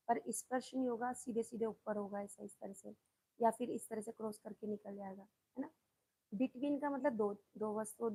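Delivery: usual speaking voice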